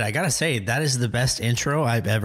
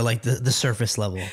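normal talking voice

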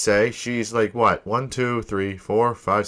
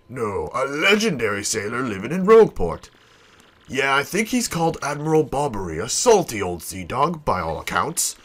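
lightly voiced salty deckhand; deferential old salt. Sailor reports a legendary helmsman to his boss, deferential. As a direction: spin the rumour like a seasoned sailor.

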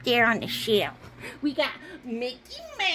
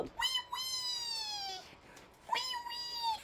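tiny high, soft wailing; pretend toy crying; 15 dB quieter, slower than usual. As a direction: squeal out a little mock cry. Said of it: "Don't cry".

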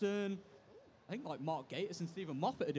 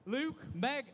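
usual speaking voice

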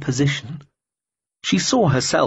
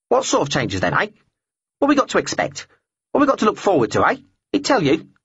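much higher, squawky bird voice; prying talking bird; 9 semitones higher, faster than usual. Acted. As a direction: pester her, squawking. Bird pesters, high far voice.

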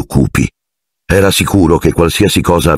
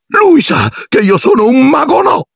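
high wailing cry; high anguished wail; anguished confession. Acted. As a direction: wail out the shameful secret. Filch's shameful confession.

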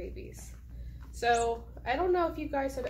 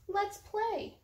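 high, bright invitation in a put-on voice; cheerful duck; 10 semitones higher than usual. Voicing duck inviting cat, high playful chirp.

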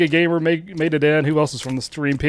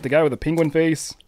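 ordinary talk, nothing marked

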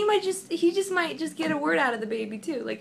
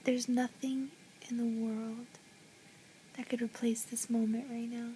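soft tender whisper; 2 semitones lower, 12 dB quieter than usual. Real, not acted. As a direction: breathe it lovingly. Whispering over the baby.

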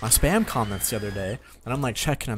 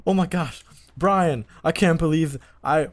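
higher-pitched, real astonishment; amazed disbelief; 6 semitones higher, 4 dB louder, slower than usual. Real, not acted. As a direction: draw it out as if you can't believe who's there. Still stunned by the encounter.

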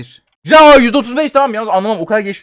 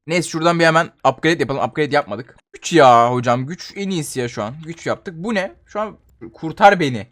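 usual speaking voice

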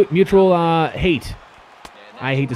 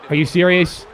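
ordinary talk, nothing marked

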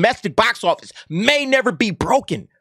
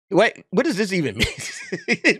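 laughing disbelief; 3 dB quieter, slower than usual. Real, not acted. Laughs reading a fan's tweet.

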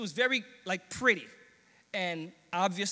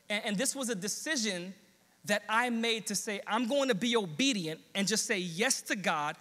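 preaching testimony; 4 semitones higher than usual. Acted. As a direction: speak it like a promise from the pulpit.

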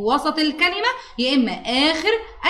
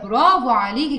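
usual speaking voice

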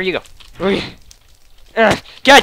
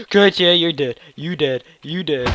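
low, soft gloating; low taunt; 6 semitones lower, 7 dB quieter than usual. Real, not acted. Gamer to a knocked-out opponent, low quiet taunt. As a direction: mutter it low over a beaten rival.

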